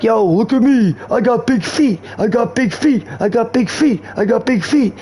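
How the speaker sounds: mock the big guy chanting it; imitating a big guy. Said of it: "to hear a big, a big galoot like that".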